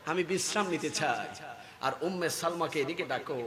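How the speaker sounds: very soft voice; hushed request. Retold or imitated. Preacher as the Prophet wishing to rest, hushed. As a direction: drop back to a gentle low level.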